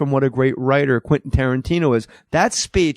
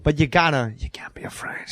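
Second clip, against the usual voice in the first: breathy whispered Walken; whispered Walken impression; 4 dB quieter than usual. Shown as a demonstration. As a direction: drop to a whisper, Walken style. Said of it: "go down into the whisper".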